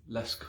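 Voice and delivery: LASK with the last A dropped, ending on the K; final A muted. Shown as a demonstration. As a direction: say "lask", no vowel at the end. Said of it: "the final A is muted".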